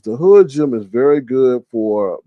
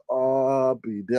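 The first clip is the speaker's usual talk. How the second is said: stunned; 9 dB quieter, much slower than usual. Retold or imitated. Stunned at seeing him.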